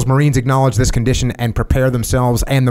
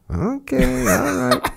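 high put-on Denzel "okay, all right"; playful Denzel catchphrase; Denzel impression; 14 semitones higher, much slower than usual. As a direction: toss it off like Denzel. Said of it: "it sounds a lot like Denzel Washington".

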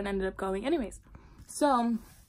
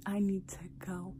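whisper; 3 semitones lower, 9 dB quieter than usual. Retold or imitated. Urgent hushed aside.

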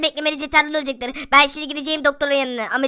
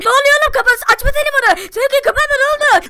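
high, loud put-on voice; alarmed friend voice; 9 semitones higher, 5 dB louder than usual. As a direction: urge the caller in high-pitched alarm. (tiz ve telaşlı arkadaş sesi)

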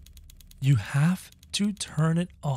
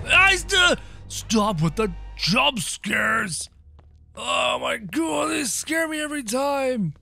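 high frightened yelling; scared shout; 8 semitones higher than usual. Real, not acted. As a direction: yell from fright after a jump scare.

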